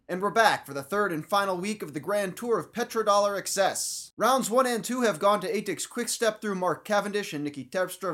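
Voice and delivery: punchy, projected recap delivery; show host intro. Pretend. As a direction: launch the show like a broadcast host. Show-opening announcement with results read.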